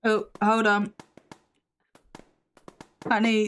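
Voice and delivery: ordinary talk, nothing marked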